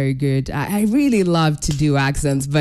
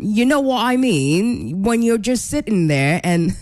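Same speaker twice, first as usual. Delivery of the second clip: British accent; 3 semitones higher than usual. Shown as a demonstration. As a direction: try out an amateur British accent. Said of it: "break out in my own, uh, British accent".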